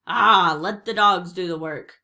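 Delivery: loud growled order from a pirate captain; growling pirate captain. A narrator's reading. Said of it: "growled Cap'n Bones".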